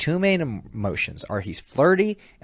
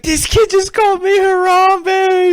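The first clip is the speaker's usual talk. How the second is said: very high, loud, drawn-out squeal; mock-anguished shriek; 10 dB louder, slower than usual. Performer inside the suit reacts to the kid, mock-anguished shriek. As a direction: shriek it in comic disbelief.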